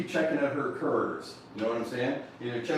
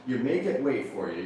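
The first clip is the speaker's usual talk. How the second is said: ordinary delivery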